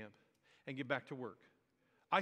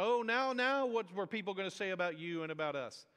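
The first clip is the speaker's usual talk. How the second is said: whiny; 3 semitones higher than usual. Retold or imitated. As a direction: open on a whiny cry of dismay. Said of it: "it sounds whiny".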